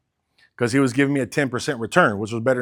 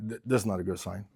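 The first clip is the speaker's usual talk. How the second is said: quiet foreboding; 6 semitones lower, 12 dB quieter than usual. Retold or imitated. Reacting to the man crying.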